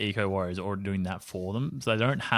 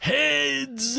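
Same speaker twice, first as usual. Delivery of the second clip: high, eager shout of the call; calling it with full hope; excited call; 3 dB louder than usual. Real, not acted.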